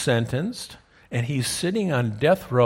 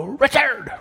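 high, louder call of the name; congregation calling out; 11 semitones higher, 4 dB louder than usual. Pretend. Preacher voicing the congregation's answer, a high called-out name.

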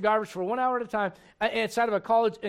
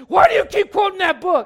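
shrill yelled mockery of the angry professor, higher and much louder; angry shout; 9 semitones higher, 11 dB louder than usual. Preacher mimics a professor yelling at him on the street, high loud shout. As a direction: bark it like the furious heckler. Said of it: "and the guy says".

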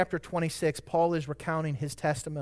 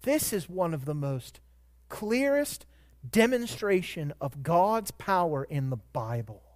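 ordinary talk

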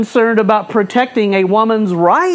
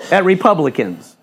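usual speaking voice

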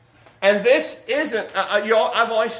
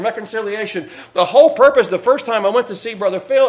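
ordinary delivery

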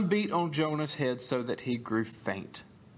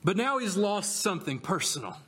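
usual speaking voice

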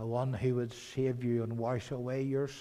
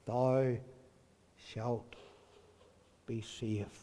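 softer, spaced with pauses; hushed reassurance; slow solemn promise; 3 semitones lower, 5 dB quieter, much slower than usual. Real, not acted. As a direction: let each word settle gently.

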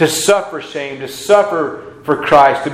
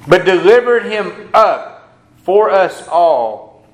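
slow, solemn, ringing reading of the verse; scripture reading; 3 semitones higher, 4 dB louder than usual. Acted. Pastor reading Romans aloud, slow and solemn. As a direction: proclaim the passage slowly.

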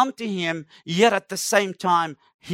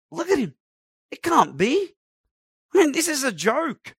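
higher, incredulous scoff; can't believe this is the king; scoffing disbelief; 6 semitones higher than usual. Retold or imitated. Pilate sizing up the beaten prisoner, higher and scoffing. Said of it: "As to say".

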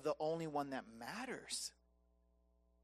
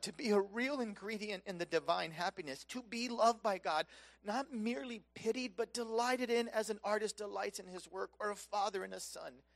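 usual speaking voice

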